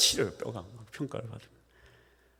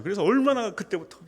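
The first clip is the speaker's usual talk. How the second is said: ordinary delivery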